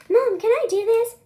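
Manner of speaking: put-on high, loud pleading kid; kid begging mom. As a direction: beg like an eager kid. Kid voiced asking mom.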